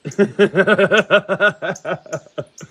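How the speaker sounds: a big burst of real laughter, high and loud; loud laugh at his own joke. Real, not acted. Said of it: "me and my silliness".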